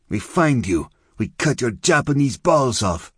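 high, loud, threatening tirade; furious. A narrator's reading. Zona Rosa threatening Gomi Boy with violence, furious. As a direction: rage at him with mounting threats. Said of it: "a furious version of her previous presentation".

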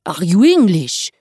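suspicious Frenchman interrogating; glaring French suspicion. A narrator's reading. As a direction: accuse in a French accent. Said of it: "Lenoir glared".

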